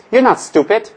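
normal talking voice

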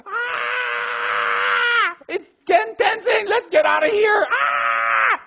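very high, full-volume shrieking; hammed-up piercing panic screams; women screaming in panic. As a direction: imitate terrified women screaming down the street. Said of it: "some women down the street screaming".

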